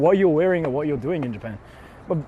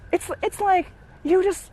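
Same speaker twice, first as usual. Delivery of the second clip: much higher breathy attempt; trying a girl voice; 13 semitones higher than usual. Shown as a demonstration. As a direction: try it out high on the breath. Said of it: "it's more about the breath control".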